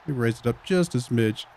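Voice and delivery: ordinary delivery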